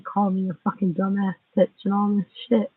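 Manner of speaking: ordinary talk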